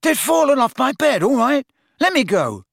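high, protesting yell; indignant shout. Acted. Dangling lad demanding release, indignant shout.

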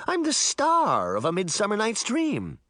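put-on high, proud boy cry; shouting. A narrator's reading. Boy claiming his starring role, mildly shouting. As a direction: proclaim it high, a mild shout. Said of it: "Puck shouted".

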